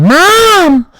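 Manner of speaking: put-on very high, loud child's cry for help; high panicked kid yelling mom. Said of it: "I yelled to my mom".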